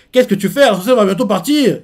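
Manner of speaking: high, loud mock call; giants calling out. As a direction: hurry someone along from afar.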